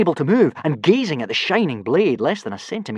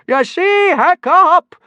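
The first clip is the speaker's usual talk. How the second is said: very high, loud, half-laughing bellow; 8 dB louder, much slower than usual. Acted. Gobber bellowing at Hiccup between laughs, very high and loud. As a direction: boom it between guffaws. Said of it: "he bellowed in between great guffaws".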